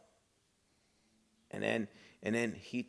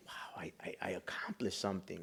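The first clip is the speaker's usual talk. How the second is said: much softer, breathless with disbelief; awed whisper; 4 semitones lower, 9 dB quieter than usual. Retold or imitated. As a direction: whisper it in amazement. Relieved at keeping the job.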